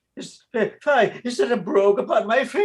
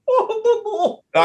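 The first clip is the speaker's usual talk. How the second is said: incredulous laugh breaking through the words, much higher; 13 semitones higher, 4 dB louder, slower than usual. Acted. Accused priest laughing off the treason charge to the officer, incredulous laughing. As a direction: scoff at the charge with a rising laugh.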